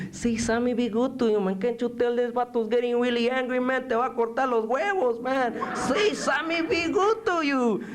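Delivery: high, wheedling barrio voice; pleading sidekick. Acted. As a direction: coax the prisoner in a high voice. Coaxing figure in poem.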